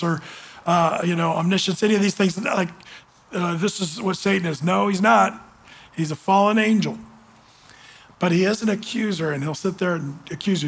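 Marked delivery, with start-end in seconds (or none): none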